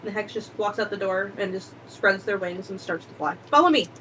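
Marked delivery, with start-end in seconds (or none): 3.5-3.9 loud, commanding call. Acted. Calling out to follow.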